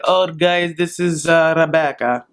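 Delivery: mimicking a reluctant, halting introduction; begrudging introduction. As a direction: introduce her as if you'd rather not. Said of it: "begrudgingly then is like".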